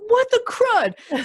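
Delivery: high, slow, laughing squeal of mock outrage; mock-offended Karen voice. Karen spotting a podcast about Karens, high and slow in mock outrage. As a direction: stretch it out, high and mock-scandalized. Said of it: "I say that in that voice".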